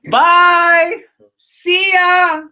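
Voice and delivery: high, drawn-out playful shout; hammed-up loud goodbye holler; mock farewell call. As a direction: call it out from a departing bus window. Mimics riders calling from departing bus.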